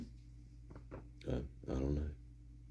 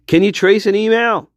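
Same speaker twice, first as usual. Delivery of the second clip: higher, louder mock-earnest question; raised pitch; 9 semitones higher, 4 dB louder than usual. Shown as a demonstration. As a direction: ask it again, pitched up and pushier. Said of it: "This is a question I've been having".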